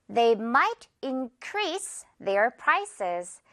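They might increase their prices, very slow and clear; slow example read. Shown as a demonstration. Teacher reading the example sentence very slowly. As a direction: read it slowly for learners to follow. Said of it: "예문 볼게요".

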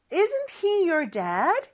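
very slow rising question; slow model question. Shown as a demonstration. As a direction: model it slowly with a rise. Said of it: "이렇게 물어보는 거죠". (천천히 끝을 올려 묻는 시범)

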